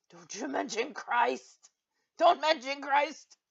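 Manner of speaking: slow, building indignant cry; mock offended outrage. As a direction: draw out each word in fake horror.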